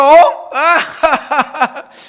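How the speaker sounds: loud, high burst of belly laughter; big laugh. Real, not acted. (크게 터뜨리는 웃음)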